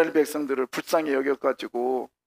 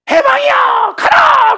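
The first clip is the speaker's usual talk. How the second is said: high, full-force shout of liberation; proclamation shout; 14 dB louder, much slower than usual. Acted. Preacher acts out the proclamation freeing the Israelites, high jubilant shout. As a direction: shout the release out to a whole crowd. Acting out the sudden proclamation of release.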